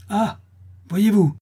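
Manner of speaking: high, loud cry of outrage; angry outcry. A narrator's reading. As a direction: exclaim like an incensed crowd. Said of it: "s'écrièrent les laboureurs courroucés". (cri de laboureurs courroucés)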